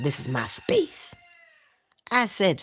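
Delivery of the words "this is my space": slow, odd little territorial voice; pet bird voice. Pretend. Voicing the pet bird guarding his space, slow and odd. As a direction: voice the rooster claiming his room. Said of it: "talking about".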